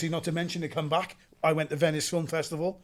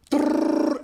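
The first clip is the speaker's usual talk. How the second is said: high, loud, breathy drrrr without voice; rattling gun noise; 10 semitones higher, 8 dB louder than usual. Retold or imitated. Describing holding the trigger down, loud rattling burst. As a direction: rattle it out like automatic fire. Imitating automatic fire.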